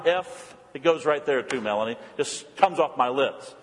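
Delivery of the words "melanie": ordinary delivery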